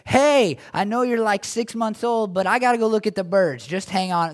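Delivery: hammed-up frazzled parent shouting; half-joking loud plea to a six-month-old; mock exasperated shout. Pretend talking to screaming baby.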